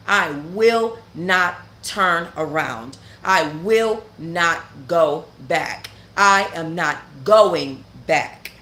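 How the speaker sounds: slow, every word weighted; slow firm vow. Real, not acted. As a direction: lay each word down like a promise. Vowing never to return to prison.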